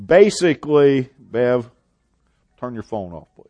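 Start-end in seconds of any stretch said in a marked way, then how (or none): none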